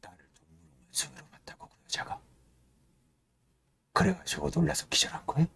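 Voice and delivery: hushed, muttered disbelief; seething whisper. Retold or imitated. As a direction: whisper it as rage builds inside. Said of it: "그런 단편적인 어떤 증오성 말들만 들리는 거예요".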